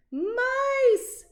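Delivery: high, loud shriek of fright; little girl scream. A narrator's reading. Little girl screaming at the mice. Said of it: "screamed a little girl".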